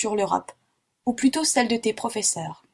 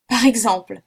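louder, indignant cry; outraged exclamation; 6 dB louder than usual. A narrator's reading. Geographer startled by the pupil, crying out louder. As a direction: burst out in disbelief. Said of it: "s'écria Paganel en arrachant ses lunettes".

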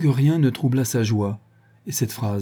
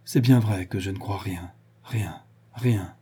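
low-spirited inner voice, fading softly; weary resignation with long gaps before the last words; discouraged, weary thought; 3 semitones lower, 3 dB quieter than usual. A narrator's reading. Woman admitting to herself, deeply weary and discouraged. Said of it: "Et découragée, profondément lasse, elle pensa".

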